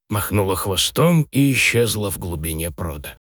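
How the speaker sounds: usual speaking voice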